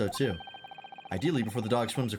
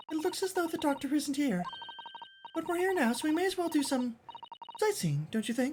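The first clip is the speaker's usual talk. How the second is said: put-on much higher, polite young assistant; high girlish assistant voice. Assistant hoping the scent leads to the swindler, high and polite. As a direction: lift into a prim young lady's voice.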